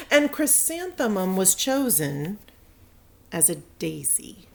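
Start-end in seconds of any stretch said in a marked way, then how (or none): 3.3-4.5 whispered reveal. A narrator's reading. Narrator revealing the disappointing role, whispered. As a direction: whisper it softly. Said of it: "dramatic whisper".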